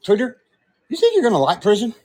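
high, quick taunting character voice; fast, high-pitched movie character impression; Tweeter. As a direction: needle someone in character. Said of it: "I can do Tweeter".

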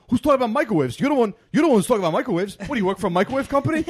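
high, loud, fast defensive snapping; angry retort. Retold or imitated. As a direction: fire back fast and offended. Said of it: "And he's like".

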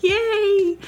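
high delighted yay; cheery yay. Retold or imitated. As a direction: cheer her on like a happy stranger. Cheerful stranger cheering her.

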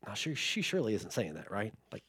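hushed, low disbelief; disbelieving parents. Retold or imitated. Parents privately doubting what their daughter said, hushed disbelief. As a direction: murmur it to each other in denial. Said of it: "Mandy and I are both like".

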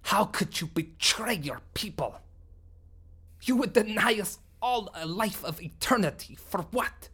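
angry alien leader. Acted. Sumblok accusing Bellry of betrayal, furious and bitter.

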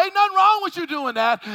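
ordinary delivery

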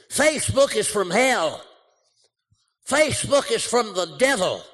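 loud, high preacher denouncing; hammed-up fire-and-brimstone preacher; preacher rant. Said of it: "every preacher".

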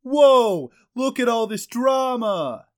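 high, loud, slow whoa for the drama; dad character voice. Acted. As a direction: make a surprised dad entrance. Character entrance line.

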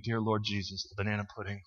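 low, soft, swooning murmur; hushed rapture. Real, not acted. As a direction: breathe it out like a prayer of longing. Swooning over remembered dessert.

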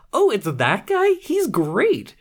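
high-voiced mock amazement at a discovery; pleasantly surprised. Acts out own pleased discovery.

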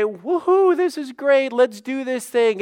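high, loud hammed-up cheering; mock celebration. Preacher imagining Jesus cheering at Lazarus's death, in mock celebration. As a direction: whoop it up like a pretend party.

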